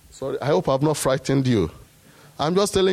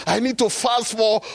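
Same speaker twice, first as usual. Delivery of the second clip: mocking his own anxious striving; mocking his old self; 14 semitones higher than usual. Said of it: "I was thinking".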